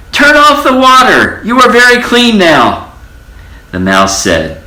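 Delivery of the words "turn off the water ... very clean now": very high loud outcry; crying out. A narrator's reading. Townspeople shouting at the mouse. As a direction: shout it as a crowd in alarm. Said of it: "people in the town cried".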